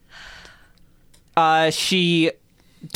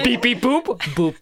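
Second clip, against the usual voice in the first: toy robot voice; 6 semitones higher than usual. Pretend. Robot language again, higher.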